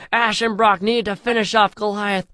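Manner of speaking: ordinary delivery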